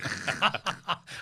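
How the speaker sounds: short, high burst of laughter; real amused cackle. Real, not acted. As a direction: laugh at a tall tale. Laughing at the tall story.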